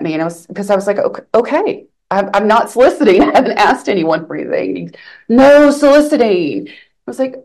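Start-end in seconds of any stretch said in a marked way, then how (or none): 1.0-4.8 high, placating self-quote; amused, raised-voice backing off; retold flustered protest. Retold protest at being told off. 5.3-6.7 loud, high mock command; hammed-up, raised-voice warning; retold forceful order. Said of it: "it was forceful".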